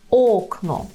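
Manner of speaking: окно with a stretched, stressed first syllable. Shown as a demonstration. Teacher models the Polish word, stress on ок in окно. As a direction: lean on ок in окно, let но fall. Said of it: "Передостанній склад ок".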